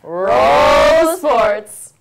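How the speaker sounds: loud, rising call to roll the segment; shouted cue. Acted. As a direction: yell it out like a show cue. Hosts cue the sports segment.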